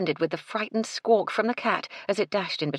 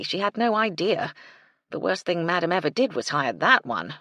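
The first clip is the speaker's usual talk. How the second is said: ordinary delivery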